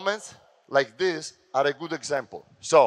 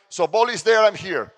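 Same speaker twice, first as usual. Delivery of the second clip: ordinary talk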